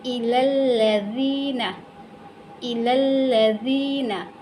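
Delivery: इलल्लज़ीना slowly, short ila into doubled l; lam joined directly. Shown as a demonstration. Teacher repeats for learners, slow recitation. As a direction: hold the long ee in इलल्लज़ीना and let it fall. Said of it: "लाम को direct लाम के साथ मिलाया".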